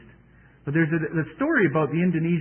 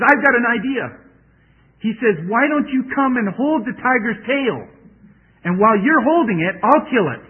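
eager idea; 8 semitones higher, 7 dB louder than usual. Acted. Holy man's clever idea.

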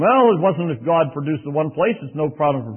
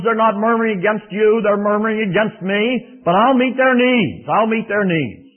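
voice of the Lord; 6 semitones higher, 6 dB louder than usual. Acted. The Lord reassuring Moses, loud and solemn. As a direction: promise it with weight. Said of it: "the Lord says to Moses".

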